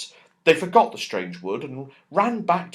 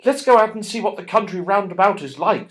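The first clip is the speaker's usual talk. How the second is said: quick, bright and eager, pitched up and louder; 8 semitones higher, 7 dB louder, faster than usual. A narrator's reading. Joe urging the others to explore, eager. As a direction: rush it out, keen to go.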